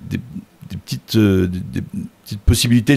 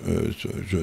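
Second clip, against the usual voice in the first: ordinary talk, nothing marked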